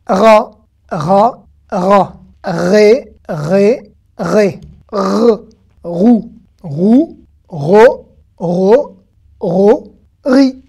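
loud, separate syllables with a rolled R; syllable drill. Shown as a demonstration. Teacher drilling the resh vowel by vowel, syllable drill. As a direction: read "Ra, Ré, rou, ro, ri" one by one, firmly. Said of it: "Lecture du Resh tout de suite".

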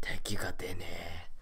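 slow, voiceless grumble; slow whisper. Real, not acted. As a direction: whisper it slowly, fed up. Grumbling as enemy won't appear. (ゆっくりささやくぼやき)

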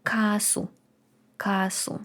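Касу voiceless and soft; whisper. Shown as a demonstration. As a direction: breathe Касу. Word demo whispered.

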